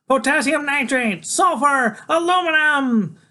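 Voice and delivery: high, loud, excited shouts; gleeful high-pitched yelling; Freak. A narrator's reading. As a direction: call out each name like a thrilled kid. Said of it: "keeps on shouting out the names".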